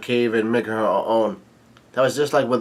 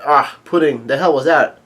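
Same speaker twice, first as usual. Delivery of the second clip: louder, higher-pitched accusation; indignant mock yell; 3 semitones higher, 6 dB louder than usual. Luffy confronting Pudding over the trick, indignant mock yell. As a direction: demand an answer, punching each question. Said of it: "yells out".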